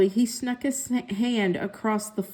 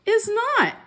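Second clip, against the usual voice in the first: bickering sister; 12 semitones higher than usual. A narrator's reading. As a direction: squeal it back at him. Siblings bickering over pencil.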